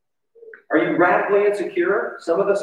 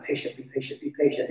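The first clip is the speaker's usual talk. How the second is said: soft, much quieter repeated mantra; self-talk mantra; 8 dB quieter, faster than usual. Retold or imitated. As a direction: murmur it to yourself.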